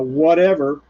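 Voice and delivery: ordinary talk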